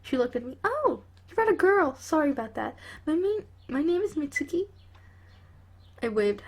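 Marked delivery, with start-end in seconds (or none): 0.6-2.7 higher, brighter-voiced mum; a mother greeting a surprise guest; lightly voiced mother. Mother greeting the girl her son brought, light higher voice. 3.0-4.7 higher, brighter-voiced mum; a mother greeting a surprise guest; lightly voiced mother. Mother greeting the girl her son brought, light higher voice.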